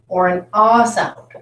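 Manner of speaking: "ah" drawn out as a broad vowel; broad A sound. Shown as a demonstration. Teacher offering students vowel choices, broad A. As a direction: open "ah", as in "father". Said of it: "its third or broad sound".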